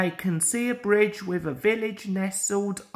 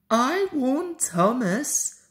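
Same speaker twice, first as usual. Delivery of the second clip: higher, slow puffed-out line; puffing engine; 7 semitones higher, slower than usual. A narrator's reading. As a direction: say it puffing a little. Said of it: "puffed Percy".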